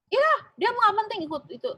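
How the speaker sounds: high, loud, brushing-off retort; dismissive boss. Retold or imitated. GM brushes off HR manager's defense, high and loud. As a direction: wave away the explanation impatiently.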